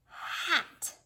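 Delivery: "Hat." swooped high and breathy; roller coaster read. Shown as a demonstration. Teacher roller coaster reads the new word, swooping up high. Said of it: "Let's roller coaster read it".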